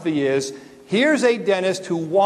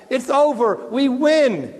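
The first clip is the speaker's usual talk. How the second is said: excited cheer; 6 semitones higher than usual. Retold or imitated. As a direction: crow that the game is won. Retelling a game about to be won.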